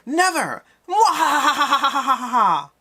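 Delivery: high, loud, slow villain cackle; falsetto evil laugh. Shown as a demonstration. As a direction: cackle like a mad scientist. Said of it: "I don't think I could do a falsetto mwahaha".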